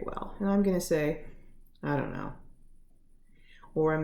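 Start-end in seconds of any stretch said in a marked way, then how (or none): 1.8-2.3 lower, mildly dejected brush-off; acting reluctant to explain, voice dropped; withdrawn non-answer. Upset person brushing off a loved one's question, withdrawn.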